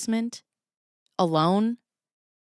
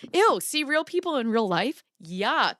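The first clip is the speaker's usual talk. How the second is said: mock disgust; 3 semitones higher than usual. Mock disgust at real people.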